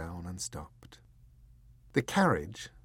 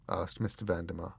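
usual speaking voice

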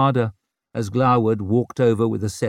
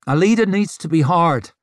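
louder, higher, hardened tone; firm insistence; 7 semitones higher, 6 dB louder than usual. A narrator's reading. Rika justifying herself at the table, firm insistence. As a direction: lay it down as a hard rule.

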